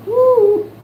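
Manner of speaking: high, loud woo of delight; excited whoop. Real, not acted. Excited at something on screen.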